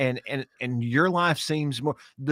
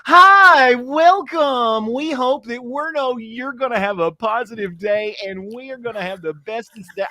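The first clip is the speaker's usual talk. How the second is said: much higher, louder, sing-song fake cheer; mocking chirpy positivity; 11 semitones higher, 8 dB louder than usual. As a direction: parody an overly upbeat morning host. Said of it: "when somebody gets up in the morning and they're like".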